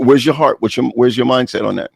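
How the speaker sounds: ordinary talk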